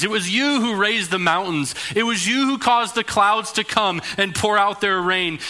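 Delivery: loud, high, mocking voice of God rebuking Job; sarcastic roar. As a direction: boom down at him with sarcastic authority. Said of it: "roars with authority to Job".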